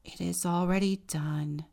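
loud go then, then a tired release; sighed. Acted. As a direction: say it with a weary sigh. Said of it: "the wren sighed".

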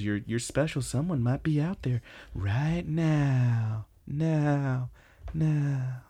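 slow, drawn-out dreamy echo; mock echo. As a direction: repeat the last word like a distant echo. Dramatic echo in acted quote.

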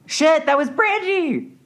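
very high, stretched exclamation; real high-pitched outburst of surprise; startled recognition. Real, not acted. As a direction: blurt it out in sudden delighted shock. Startled by coworker yelling outside.

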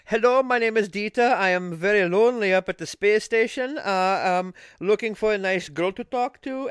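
put-on German accent, standing in for Dutch. Astronaut seeking a girl to talk to, in a German accent. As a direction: speak like a lonely German astronaut. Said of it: "I'm doing a German accent".

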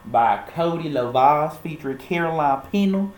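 put-on southern accent; playful, cringy southern accent. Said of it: "let me drop this accent".

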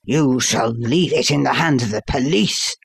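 understated angry threat; narrated fury, mostly held in; grief and rage. A narrator's reading. Father threatening his son with police, mild rage. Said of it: "half mad with grief and rage".

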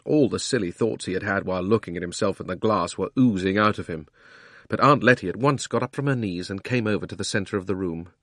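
usual speaking voice

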